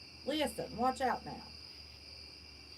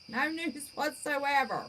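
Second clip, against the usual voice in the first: usual speaking voice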